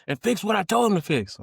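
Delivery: loud, high angry yell through a wall; old man yelling. Retold or imitated. Old man on the toilet yelling at the kid, angry shout. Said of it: "He's like".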